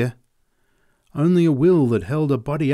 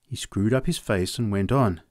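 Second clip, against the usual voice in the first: ordinary delivery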